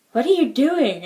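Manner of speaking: playfully exaggerated astonishment; mock disbelief. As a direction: imitate a baffled relative demanding an explanation. Family's shocked question acted out.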